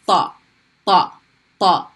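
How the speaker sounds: Ta said heavy, tongue up. Shown as a demonstration. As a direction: repeat ta, emphatic. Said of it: "heavy T sound".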